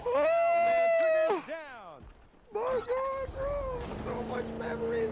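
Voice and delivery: a high gushing cry of nostalgia; nostalgic outburst. Real, not acted. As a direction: burst out like memories flood back.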